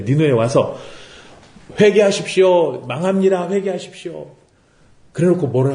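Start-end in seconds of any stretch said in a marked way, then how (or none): 1.8-4.3 Jonah proclaiming doom. Acted. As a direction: call out a warning of doom, mimicking a street prophet. Jonah voiced preaching doom in Nineveh.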